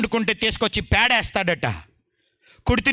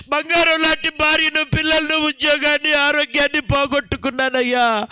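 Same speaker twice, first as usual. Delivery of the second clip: higher, imitating a wailing confession of losses; retold crying shout; 5 semitones higher than usual. The drunk confesses his losses to the preacher, imitated sobbing wail. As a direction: list each loss as if sobbing it out. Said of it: "పెద్ద పెద్దగా అరుస్తూ, ఏడుస్తూ".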